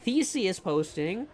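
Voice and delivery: very slow "Theses" with a careful TH; corrected pronunciation. Shown as a demonstration. Correcting chat who heard feces, slow emphatic TH on "Theses". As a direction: spell it out by ear, "Theses" starting TH. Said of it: "Not what I'm saying".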